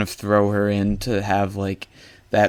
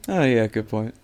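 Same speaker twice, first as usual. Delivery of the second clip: softer, let-down imitation of giving in; mock meek concession; 6 dB quieter than usual. Teasing a co-host who gave in at once, meekly deflated. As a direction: imitate a passionate arguer quietly conceding. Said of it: "He was so passionate, and he said".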